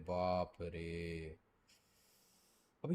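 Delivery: usual speaking voice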